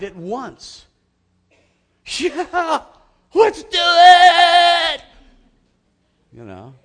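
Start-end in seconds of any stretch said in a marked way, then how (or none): 2.1-5.0 frat-party crowd yelling high and loud; Animal House rowdy cheer. Retold or imitated. The people answer Nehemiah with a rowdy Animal House cheer. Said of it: "it's my best Animal House interpretation".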